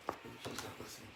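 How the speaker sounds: hushed, breathy whisper to someone nearby; whispered aside. Real, not acted. Child whispering an aside while mom reads. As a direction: drop to a secret whisper.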